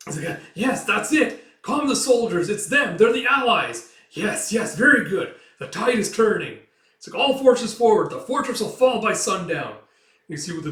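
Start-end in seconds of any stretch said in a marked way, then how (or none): 0.5-6.6 high, loud triumphant yelling; thrilled battlefield commander; excited shout. Acted. Commander cheering allies arriving. 7.2-9.8 loud, high rallying order; rally the army forward; battle command. Acted.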